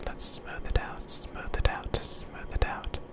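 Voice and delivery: a faint echo of smooth it out; distant fading whisper. Acted. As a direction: let the words drift away into the distance. Repeated phrase fading away.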